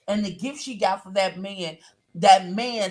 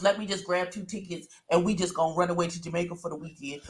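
quick, softer aside; offhand retold plan; 2 semitones lower, 7 dB quieter, much faster than usual. Host voices a man's careless trip plan, quick and offhand. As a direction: rattle it off lightly, as an imagined thought.

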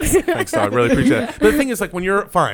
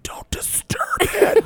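slow, high, drawn-out whisper; hushed warning whisper; much slower than usual. Acted. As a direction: whisper as if near a rare bird. Whispering near a rare bird.

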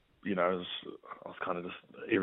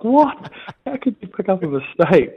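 high, loud indignant What; indignant disbelief; 11 semitones higher, 9 dB louder than usual. Retold or imitated. Son protesting Dad's correction, high loud indignant disbelief. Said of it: "I'm like".

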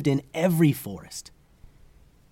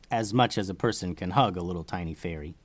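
ordinary delivery

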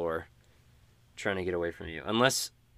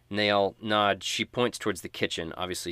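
normal talking voice

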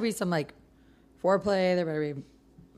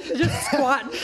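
high laughing quip; 6 semitones higher, 4 dB louder, much slower than usual. Real, not acted. Laughing tease.

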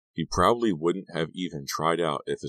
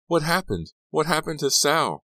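high, loud frantic questions; panicked; 12 semitones higher, 5 dB louder than usual. A narrator's reading. Girlfriend running up to the injured player, panicked. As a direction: cry out in alarm, pitch way up.

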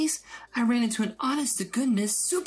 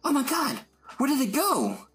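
much higher, amazed outcry; astonished. Acted. Erica loses the heroes on camera, amazed and much higher. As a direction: gasp out where did it go.

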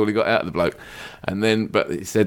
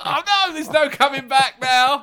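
high mock-horrified; much higher mock dismay; mock panic. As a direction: play the barber's fake despair. Quoting barber in mock panic.

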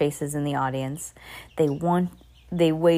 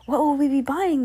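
high, excited question; curious crowd crying out; eager cry; 8 semitones higher, 4 dB louder than usual. A narrator's reading. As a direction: ask high, bursting to know. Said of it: "they cried".

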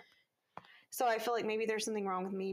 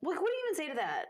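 incredulous; 5 semitones higher, much faster than usual. Real, not acted. Disbelief continues.

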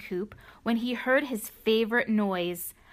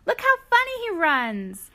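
put-on excited little girl; 9 semitones higher, 5 dB louder than usual. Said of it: "cried the farmer's granddaughters".

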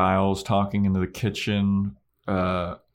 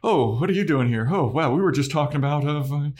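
high, loud, stammering mock cover-up; hammed-up guilty nonchalance; fake casual fluster; 7 semitones higher, 3 dB louder than usual. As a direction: greet too eagerly while scrambling for an excuse. Acting out secretive people when interrupted.

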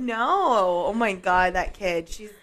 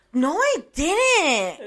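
higher, very drawn-out mock protest; mad child's denial; 6 semitones higher, much slower than usual. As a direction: imitate an angry kid stretching out a denial. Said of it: "she would get so mad".

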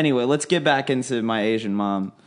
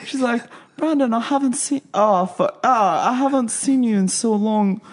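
imitating mom, pitched way up; 8 semitones higher than usual. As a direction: gush like an overjoyed mother.

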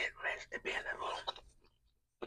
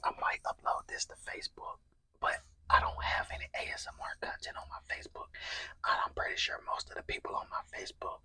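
whispering; 6 dB louder than usual. Real, not acted. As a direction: whisper close to the mic while eating. ASMR mukbang whispered throughout.

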